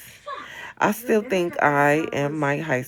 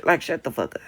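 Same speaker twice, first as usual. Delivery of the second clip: normal talking voice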